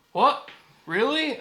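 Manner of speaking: high, loud incredulous questions; imitated disbelief. Guy at pizza joint hearing the news, imitated disbelief. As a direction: act out a stunned double-take.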